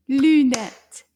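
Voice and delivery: "Lunette" in two loud, punched beats; clapped syllables. Shown as a demonstration. Teacher counts syllables with the class, "Lunette" clapped out loudly. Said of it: "Let's clap together".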